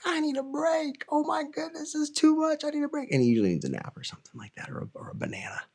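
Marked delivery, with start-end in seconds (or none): 0.0-3.1 put-on high squeaky toddler voice, much higher; dad imitating his toddler son who wants a break. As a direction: play the worn-out two-year-old begging for a break. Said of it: "He's like".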